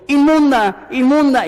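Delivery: hammed-up yell of unclean as if warning passers-by; shouting unclean. Preacher acting out a woman crying out in the street, loud high mock shout. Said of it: "caminando en la calle gritando".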